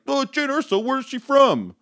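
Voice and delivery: high, fussy fan voice asking; mock whiny fan. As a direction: whine the question like a nitpicker. Mocking a nitpicking fan.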